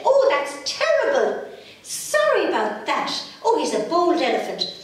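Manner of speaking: high, shocked mother apologising; a mum aghast at the news, pitched high. Acted. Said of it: "got an awful shock".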